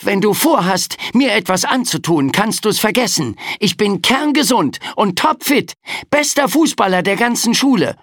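put-on high, fast-talking frightened boy; aufgeregter Junge. A narrator's reading. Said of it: "ergriff das Wort". (aufgeregter Junge mit hoher Stimme)

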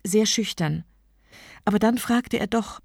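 plain reading voice

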